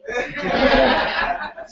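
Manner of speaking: short amused laugh. Real, not acted. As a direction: chuckle at it. Laughs at her own story.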